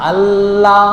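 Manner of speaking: আল্লাহ intoned slowly, L held; level, sustained আল্লাহ with a lingering L; time on the L. Shown as a demonstration. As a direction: take time on the double L in আল্লাহ. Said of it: "কিছুক্ষণ সেখানে সময় নিচ্ছে".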